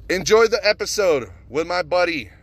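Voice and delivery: high, drawn-out host introduction; big high-pitched intro call; show announcer. Pretend. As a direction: announce the guest, stretching out the name. Host announces his guest's episode.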